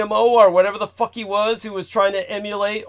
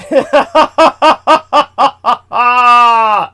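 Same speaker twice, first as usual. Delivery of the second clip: loud laugh; 5 semitones higher, 10 dB louder than usual. Real, not acted. Big laugh at joke.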